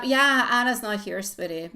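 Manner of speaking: normal talking voice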